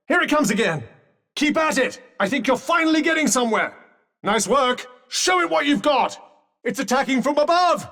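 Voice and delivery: high, excited cheering-on; lifted, eager battle calls; rousing combat call. Acted. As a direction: urge a fighter on mid-battle.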